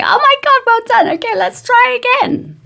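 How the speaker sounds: gushing, loud, high delight; nurturing praise. Shown as a demonstration. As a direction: cheer on a child learning to walk. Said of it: "You would just say beautiful, lovely, nurturing things".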